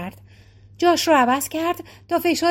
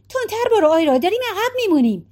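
more insistent urging; 7 semitones higher, faster than usual. Acted. Maggie urging again, more insistent.

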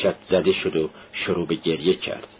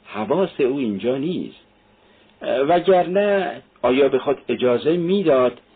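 put-on mildly drunken calling out, pitch climbing; a touch of drunken loudness; tipsy shout; 5 semitones higher than usual. A narrator's reading. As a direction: let the drink show as you protest.

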